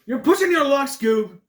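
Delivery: high, loud mock telling-off; mock stern scolding. [name] scolding himself as Scoob, loud and high. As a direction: scold yourself like a cartoon. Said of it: "scolding himself mock".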